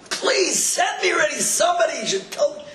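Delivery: high-pitched imploring cry; retold desperate plea. Driver's retold plea to God.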